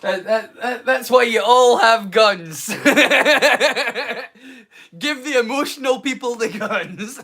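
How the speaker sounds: high-pitched, breaking into laughter; real laughter through the words; laughing. Real, not acted. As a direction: crack up at your own joke. Cracking up at own joke.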